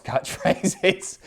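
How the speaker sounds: usual speaking voice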